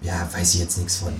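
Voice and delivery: soft, mumbled feigned ignorance; playing dumb. Debtors brush off creditors, quiet and playing dumb. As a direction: shrug it off, playing innocent. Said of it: "stellen uns einfach dumm und sagen".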